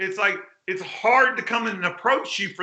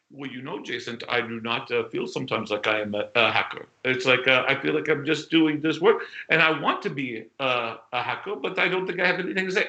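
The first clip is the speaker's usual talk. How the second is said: put-on terrible French accent; 7 semitones lower than usual. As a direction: do a bad French accent, confiding and unsure. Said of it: "I do terrible accents, by the way".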